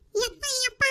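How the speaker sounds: put-on high, soft pleading child voice; begging child. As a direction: whine like a little girl begging. Child begging father for treat. (ಬೇಡುವ ಮಗುವಿನ ಧ್ವನಿ)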